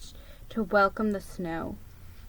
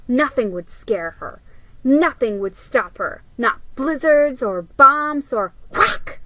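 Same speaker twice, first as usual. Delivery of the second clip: building bravado; 3 semitones higher, 6 dB louder than usual. A narrator's reading. Molly's heroic daydream builds.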